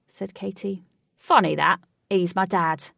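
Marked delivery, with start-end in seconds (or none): 1.2-2.9 cheeky loud quip. A narrator's reading. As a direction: tease with a grin. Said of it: "said Ajax cheekily".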